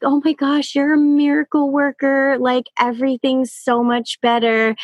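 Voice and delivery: imitating a grateful mom in a high voice; gushing mom. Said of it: "a mom was like".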